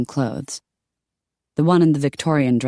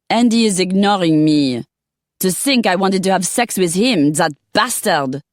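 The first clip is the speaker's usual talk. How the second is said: put-on higher, louder indignant French accent; angry French accent; 5 semitones higher, 4 dB louder than usual. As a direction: spit it out like a scorned Parisian.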